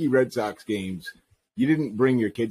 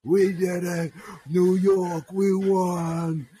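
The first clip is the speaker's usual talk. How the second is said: rowdy fan cheering; 7 semitones higher, much slower than usual. Retold or imitated. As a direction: whoop it up like a drunk fan. Drunk fan celebrating after a win.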